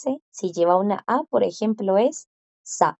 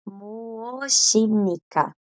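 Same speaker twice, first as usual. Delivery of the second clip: slow sounding out; much slower than usual. Shown as a demonstration. Teacher models the formal ending for students. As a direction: drag "muoshimnika" out slowly, syllable by syllable. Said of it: "mo formal".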